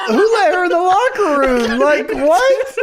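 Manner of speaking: high, incredulous laughing outburst; laughing disbelief. Real, not acted. Co-host reacting to the absurd locker-room scene, laughing in disbelief. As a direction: crack up in disbelief, pitch way up.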